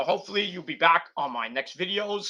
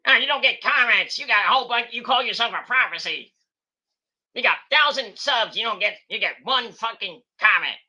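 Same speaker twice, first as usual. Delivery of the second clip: higher, louder sneering mimic of a whiny commenter; mocking commenter voice; 4 semitones higher, 4 dB louder than usual. Vlogger mimicking viewers who whine about comments, sneering. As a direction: parody the complainers back at them.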